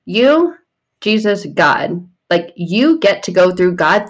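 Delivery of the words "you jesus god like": usual speaking voice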